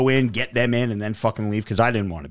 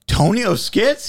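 surprised recognition; 10 semitones higher, 3 dB louder than usual. Retold or imitated. Recognizing a famous guy in person.

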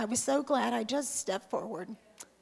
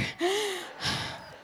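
laugh; 9 semitones higher, 3 dB quieter than usual. Real, not acted. Laughing at her own reluctance.

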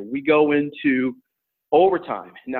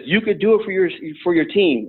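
higher, urgent coaching plea; panicked coach; 6 semitones higher than usual. Retold or imitated. Coach pleading with his tired wrestler, mildly panicked. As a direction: urge him on like a coach in a light panic. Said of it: "all in a panic".

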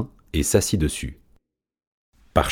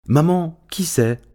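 chick calling out; 11 semitones higher, slower than usual. Acted. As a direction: call out to maman, pitched high. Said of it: "cria le poussin".

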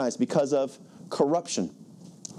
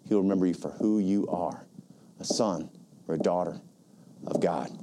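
tender appeal; 8 semitones lower than usual. Real, not acted. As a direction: speak gently as if to one person. Tender appeal to repentant listeners.

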